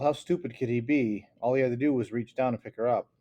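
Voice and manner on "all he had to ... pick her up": ordinary delivery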